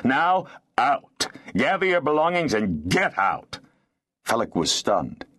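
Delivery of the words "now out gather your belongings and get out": raised, commanding lordly voice; harsh, imperious command; stern eviction order. A narrator's reading. Lord angrily orders tenants out.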